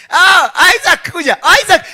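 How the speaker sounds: doting father calling his son Isaac; fond father calling. Acted. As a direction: call the boy over with a laugh.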